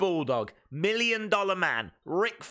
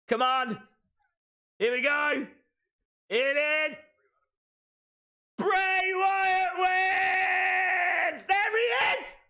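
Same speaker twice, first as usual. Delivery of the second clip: excited cheering; 13 semitones higher, much slower than usual. Real, not acted. Streamer cheering live as Bray Wyatt wins, excited shouting. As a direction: cheer him over the line, full thrill.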